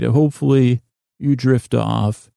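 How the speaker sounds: ordinary delivery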